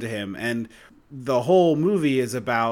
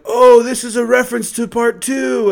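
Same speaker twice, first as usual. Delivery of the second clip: mock loud, high-pitched excited commentary; high, loud mocking impression; loud movie narrating; 8 semitones higher, 8 dB louder than usual. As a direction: imitate an oblivious moviegoer announcing it to everyone. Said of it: "it wasn't like a whisper".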